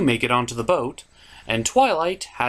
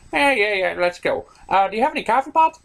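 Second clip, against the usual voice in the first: eager character voice; 6 semitones higher, faster than usual. Acted. Acted skit, eager character voice.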